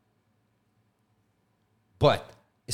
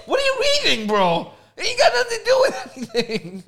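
laughing disbelief; 4 dB louder than usual. Retold or imitated. Friend reacting, laughing.

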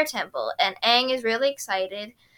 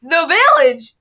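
mock line reading; 5 semitones higher, 12 dB louder than usual. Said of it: "Katara's line sounds really stupid".

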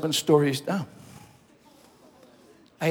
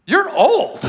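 higher, louder astonished blurt; shocked cop; 13 semitones higher, 10 dB louder than usual. Retold or imitated. Officer shining a light on the pastor's face, shocked blurt. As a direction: blurt it out in disbelief.

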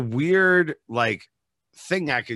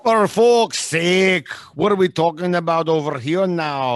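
Scottish accent; 6 semitones higher, 6 dB louder than usual. Shown as a demonstration. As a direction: stretch the curse out in broad Scottish, much higher. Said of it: "That's definitely Scottish".

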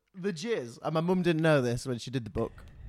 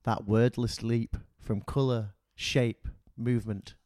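slow, lower poem reading with long pauses; poetry voice; 4 semitones lower, slower than usual. Acted. Son reads his mum's poem to friends, poetry voice. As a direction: set each word apart like solemn verse. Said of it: "get my poetry voice on".

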